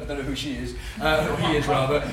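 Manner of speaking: ordinary talk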